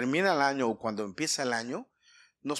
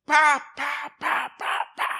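high, percussive "pa" bursts, dying down; imitated arrow shots; 12 semitones higher, 6 dB louder, much slower than usual. Preacher mimics the warrior shooting every arrow, percussive. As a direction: fire off spoken shots one by one.

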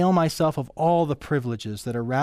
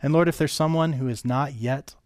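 prayer; 2 semitones lower than usual. Acted. Pastor leads closing prayer.

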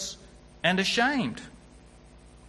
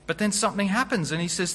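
normal talking voice

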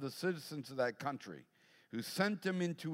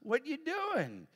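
high, drawn-out daughter voice, much higher; tipsy daughter; 11 semitones higher, 4 dB louder, much slower than usual. Retold or imitated. Daughter calling her dad late at night, tipsy and high. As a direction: stretch it out like a tipsy girl. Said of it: "she's like".

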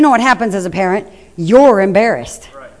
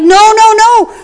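higher, louder "no, no, no"; scolding mom; 6 semitones higher, 6 dB louder than usual. Retold or imitated. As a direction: mom snapping at a kid. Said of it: "Mom's like".

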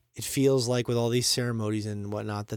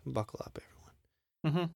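mock whisper; 11 dB quieter, faster than usual. Joke safety advice to listeners.

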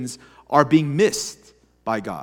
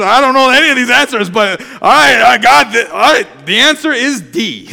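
quick, louder, half-laughing guess; fast, louder test-taking panic, played for laughs; flustered guessing; 4 semitones higher, 6 dB louder than usual. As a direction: bluff through the test.

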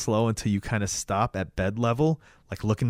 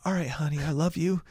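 high, soft sweet mother voice; mock a mom soothing her son; imitated tender mom; 6 semitones higher, 5 dB quieter than usual.

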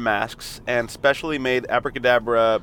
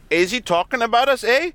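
mock Canadian accent; 10 semitones higher than usual. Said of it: "That's the problem with Canadians".

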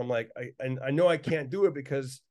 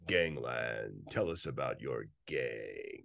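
very low, slow, grave narrator voice; deep ominous TV documentary intro; 9 dB quieter, much slower than usual. Retold or imitated. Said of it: "they'd be like".

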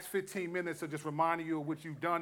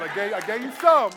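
teasing shout; 3 semitones higher, 11 dB louder than usual. Real, not acted. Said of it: "you gotta wait till next week".

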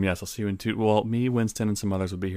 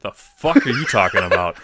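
mock outburst; 10 dB louder than usual. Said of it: "I'd be like".

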